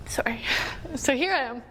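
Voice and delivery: choked-up, collecting herself; small apology, then push on through tears; real tearful apology. Real, not acted. Speaker apologizing for crying mid-story, tearfully collecting herself.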